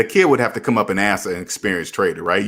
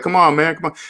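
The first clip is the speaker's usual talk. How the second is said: higher, urging come on, man; pleading with friend; 8 semitones higher, 3 dB louder than usual. Retold or imitated. Urging a close friend to pay off loans, pleading higher. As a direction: coax a stubborn friend, pitched up. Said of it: "it's like".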